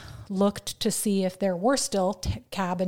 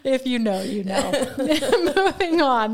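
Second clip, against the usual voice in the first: laughing; 4 semitones higher, 5 dB louder than usual. Real, not acted. Laughing at the joke.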